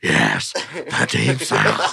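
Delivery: very low, drawn-out character voice; movie demon impression. Answering in a film exchange, deep slow impression. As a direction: drop right down and stretch it slowly.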